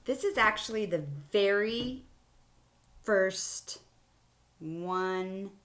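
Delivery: usual speaking voice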